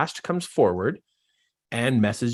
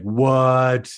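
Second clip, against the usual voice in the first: shocked; 6 dB louder than usual. Retold or imitated. Acting his own shock.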